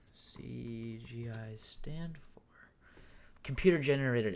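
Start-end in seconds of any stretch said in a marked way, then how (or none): 0.1-2.4 very slow, drawn-out whisper; whispering to self. Real, not acted. Looking up what it stands for, slow absent whisper. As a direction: whisper it absently, stretching each word. Murmurs while looking something up.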